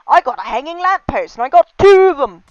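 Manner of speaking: hammed-up loud, high boast; mock excited exclamation. Player announcing a find, mock excited. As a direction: blare it out with mock glee.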